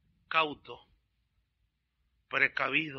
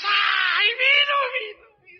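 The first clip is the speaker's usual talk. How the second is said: very high, loud, slow mock-tearful cry; crowd ecstasy; 11 dB louder, much slower than usual. The crowd cries at the miracle, very high and loud. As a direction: wail it like a weeping faithful. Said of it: "Y todos".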